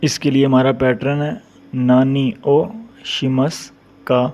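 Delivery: ordinary talk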